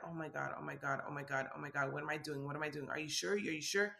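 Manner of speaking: quiet freak-out under her breath; panicked inner voice. Retold or imitated. Inside her own head, a soft panicked freak-out. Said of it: "But inside, I'm like".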